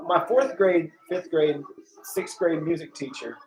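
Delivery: normal talking voice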